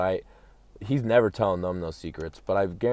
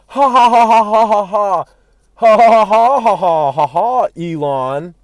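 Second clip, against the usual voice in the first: loud, high fake cackling laugh; mocking laughter; 12 semitones higher, 13 dB louder than usual. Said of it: "They probably laugh at him first".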